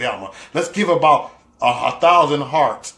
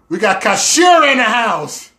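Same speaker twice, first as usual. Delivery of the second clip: hype announcement; 8 semitones higher, 7 dB louder than usual. Real, not acted. Announcing a viewer's arrival.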